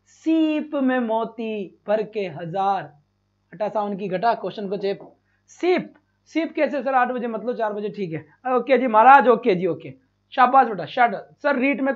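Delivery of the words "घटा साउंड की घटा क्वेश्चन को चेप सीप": ordinary talk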